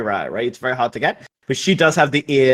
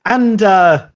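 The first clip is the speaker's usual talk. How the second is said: higher-pitched, halting social greeting; Marie Joanne greeting; 8 semitones higher than usual. Acted. Marie Joanne greets Victoria and friends, high social greeting. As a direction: play a high-voiced acquaintance hailing someone. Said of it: "Marijuan comes out and says".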